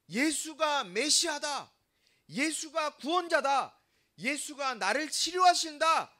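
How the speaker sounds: emphatic declaration. Acted. Preacher voicing Bartimaeus's confession of faith, proclaiming loudly. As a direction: proclaim each statement firmly, stretching the words.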